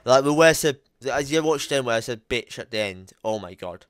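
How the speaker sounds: normal talking voice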